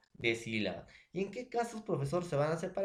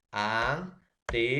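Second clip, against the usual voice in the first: "Anteojos" stretched out slowly, syllable by syllable; slow demonstration; much slower than usual. Shown as a demonstration. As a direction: spell "Anteojos" out slowly for the class. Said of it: "una vocal abierta E y una vocal abierta O". ("Anteojos" dicho lento, sílaba por sílaba, para la clase)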